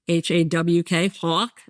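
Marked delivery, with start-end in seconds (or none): 1.1-1.6 Jersey "hawk" with a rounded aw; old New Jersey accent. Shown as a demonstration. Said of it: "is how I used to say it".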